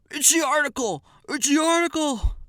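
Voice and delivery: high, loud mock shriek; very upset. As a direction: mimic someone crying out in outraged alarm. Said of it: "And he was very upset".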